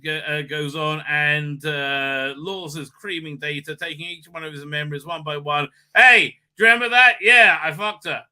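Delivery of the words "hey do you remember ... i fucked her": mocking taunt. Villain taunts Data.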